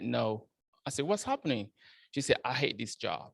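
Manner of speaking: ordinary delivery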